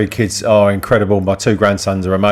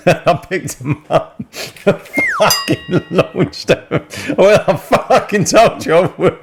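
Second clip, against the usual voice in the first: high, slow, laugh-broken telling; giggling through the story; can't stop laughing while telling; 9 semitones higher, much slower than usual. Real, not acted. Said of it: "I don't know why I'm laughing".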